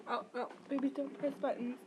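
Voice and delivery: usual speaking voice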